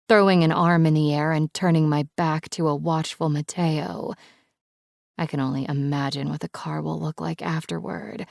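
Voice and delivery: plain reading voice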